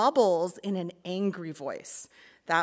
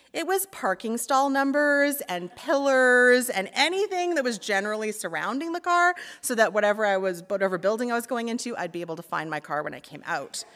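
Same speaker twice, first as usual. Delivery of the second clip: ordinary talk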